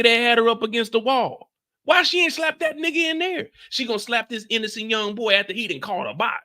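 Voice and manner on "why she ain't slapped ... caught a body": ordinary delivery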